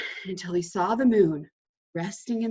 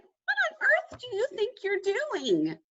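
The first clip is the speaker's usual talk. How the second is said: much higher, puzzled little rabbit. A narrator's reading. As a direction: play a startled rabbit. Rabbit's voice, high.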